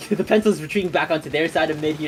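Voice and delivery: normal talking voice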